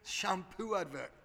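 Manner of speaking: ordinary delivery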